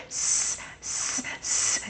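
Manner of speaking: long, soft snake hiss; a whispered s like a snake; steady, quiet hissing s; hissing s sound. Shown as a demonstration. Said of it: "Can you make that sound with me?".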